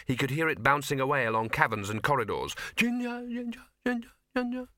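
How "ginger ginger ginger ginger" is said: fading echoing call. Acted. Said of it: "His voice boomed back at him".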